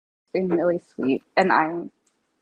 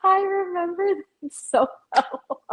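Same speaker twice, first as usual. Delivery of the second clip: laughing; 12 semitones higher than usual. Real, not acted. Laughing at shared family memory.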